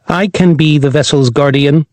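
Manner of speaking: lightly voiced robot companion; a hint of a polite robot dog. Robot Corgi character voice.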